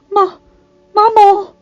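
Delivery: high, loud gasped cry of Mama; gasping. A narrator's reading. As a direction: choke it out, breathless and high. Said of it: "he gasped".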